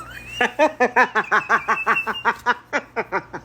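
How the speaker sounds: long helpless laugh; real laughter. Real, not acted. Laughing hard at the video. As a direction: let the laugh roll out. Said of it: "I can't stop laughing".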